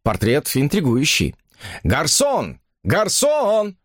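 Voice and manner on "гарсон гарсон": drawn-out, rising summons to a waiter; calling out. A narrator's reading. Man waving wine list for the waiter, calling out. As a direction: call for the waiter, voice climbing high on the second call. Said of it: "позвал он".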